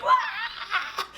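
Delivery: held-back comic shriek; mock scream. Heroine sucked under the tracks, mock high scream.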